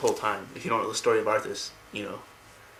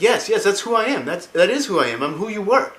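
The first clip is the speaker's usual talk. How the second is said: put-on much higher, excited young boy voice; a sick kid suddenly full of hope, loud and high; dying boy's voice; 8 semitones higher, 4 dB louder than usual. Dying boy answers Arthas, high and eager. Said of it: "And he's like".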